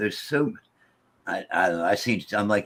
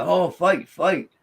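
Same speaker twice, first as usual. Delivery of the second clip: a raised, higher-pitched mock holler; retold excited holler, pitched up; 7 semitones higher, 4 dB louder, slower than usual. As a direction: call it out like kids yelling about a fight. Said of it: "they would holler".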